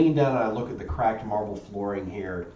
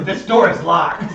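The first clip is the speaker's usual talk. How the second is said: loud announcement; 7 semitones higher, 8 dB louder, slower than usual. Acted. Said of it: "I turn around and go".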